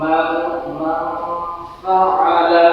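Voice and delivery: "মান ফাআলা" with the ন hummed through the nose and "ফাআলা" stretched; nasal ghunna recitation. Shown as a demonstration. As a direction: hide the ন of "মান" in a nasal hum. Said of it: "তাহলে উচ্চারণ হবে".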